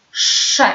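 "Sze" with a long hushing sz before the vowel; drawn-out SZ. Shown as a demonstration. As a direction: model the syllable "Sze", sz held long. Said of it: "Pamiętamy język wysoko".

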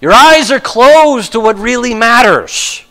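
ordinary delivery

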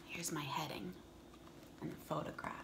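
whispered aside. Real, not acted. Showing book pages to kids.

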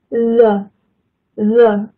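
the held long with a buzzing voiced th, tongue between teeth; demo of the. Shown as a demonstration. Teacher models the voiced th for a student. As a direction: let the th in The vibrate on the lips. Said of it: "嘴巴有一点点震动".

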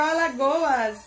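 ordinary talk

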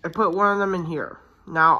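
ordinary talk

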